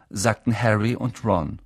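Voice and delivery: plain reading voice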